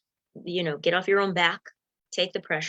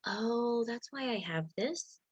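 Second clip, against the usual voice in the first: whispered aha moment, slow; hushed realization; 3 semitones higher, 9 dB quieter, slower than usual. Retold or imitated. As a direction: breathe it out as a quiet discovery.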